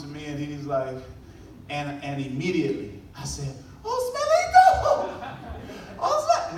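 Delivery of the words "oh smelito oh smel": very high, loud, delighted cry of his son's nickname; joyful cheer. Retold or imitated. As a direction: beam with pride and shout his name. Father cheering son who came last.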